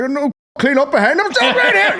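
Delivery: a hint of a bouncer dad. Impersonating his dad as a Belfast bouncer, lightly voiced. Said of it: "turn into my dad a wee bit".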